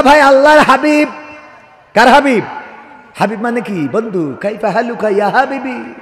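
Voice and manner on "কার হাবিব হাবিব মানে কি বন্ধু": ordinary talk, nothing marked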